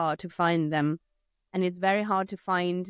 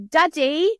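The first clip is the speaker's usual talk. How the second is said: put-on high childish call with an Italian-accented ladri; a child anxiously calling Daddy; 9 semitones higher, 6 dB louder than usual. Child warning Daddy about thieves, high and urgent. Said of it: "they would say".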